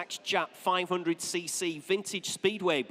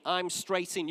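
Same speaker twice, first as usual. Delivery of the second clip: usual speaking voice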